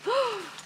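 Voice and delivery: quick, high gasp of delight; gasp, thrilled by the pencils. Real, not acted. Finding spooky pencils in the box, a high gasp.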